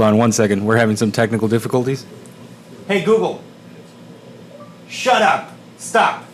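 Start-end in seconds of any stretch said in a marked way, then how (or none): 2.9-6.2 high-pitched, separated orders; raised-pitch calls to a device across the room; calling out commands. Real, not acted. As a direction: command a stubborn voice assistant. Commanding the smart speaker to stop.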